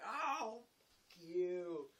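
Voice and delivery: loud, high mock outrage; exasperated comeback. Teased after the hammer joke, mock exasperated. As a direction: react like you've just been had.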